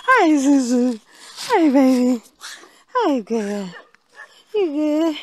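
high, loud cooing pet talk. Real, not acted. Greeting her dog in baby talk.

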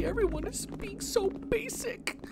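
soft, breathy thanks; whisper. Real, not acted. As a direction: whisper it so no one hears. Whispering in eerie level.

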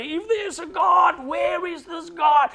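high, drawn-out cry of appeal; imitated crying out. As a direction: voice desperate believers calling out to God. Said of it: "they crying out and say".

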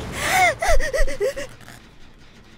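sharp gasp. Real, not acted. Sudden scare.

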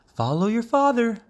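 high mother frog instruction. A narrator's reading. Mother frog to her young, high-pitched directing. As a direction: send them on in a high voice.

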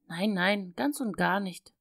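ordinary delivery

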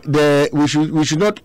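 ordinary talk, nothing marked

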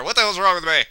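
normal talking voice

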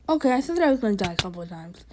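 ordinary talk, nothing marked

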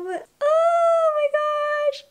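high, loud, tearful cry of joy; overjoyed, voice breaking into tears; happy crying. Real, not acted. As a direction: burst out crying with happiness. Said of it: "I'm trying not to cry right now".

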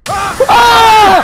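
long, high, loud shriek; jump-scare scream. Real, not acted. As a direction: scream out in sudden fright.

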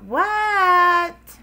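high, loud, drawn-out reply; yelling back. Acted. Froggy answers Dad from his room, yelling back. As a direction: shout back like a bothered kid.

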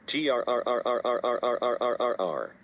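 a long rolling growl like a cornered wolf. Acted. Panicking wolf growling as his friend watches, rolled growl.

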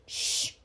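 short hissed "shh"; warning shush. A narrator's reading. Brother stopping sister's protest, warning shush. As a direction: hush her in warning. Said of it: "he warned me".

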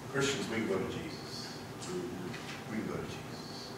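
very soft, low pulpit voice; hushed appeal. Real, not acted. Preacher pointing Christians to Jesus, hushed appeal. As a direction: drop right down and gently reassure the congregation. Tender aside after the story.

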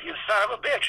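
pitched well up, ending on a rise; high delighted curse. Retold or imitated. Walt repeating the curse to Rolly, high and delighted. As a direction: throw it up high, half admiring.

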